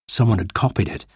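ordinary delivery

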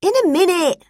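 put-on boy putting off his sister excitedly. A narrator's reading. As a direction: brush her off, excited. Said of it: "said Tim excitedly".

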